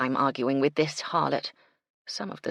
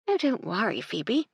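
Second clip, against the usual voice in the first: lightly higher patronising reassurance; a shade condescending; 6 semitones higher than usual. Acted. Madeline to Phoebe, mildly condescending. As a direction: talk down to her gently. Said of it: "a shade condescending".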